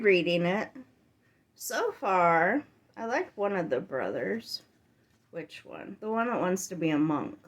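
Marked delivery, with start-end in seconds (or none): 5.3-6.0 "Which one?" whispered to herself; whispered aside. Real, not acted. Reader pausing to recall a character, whispering to herself. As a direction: breathe the question under your breath.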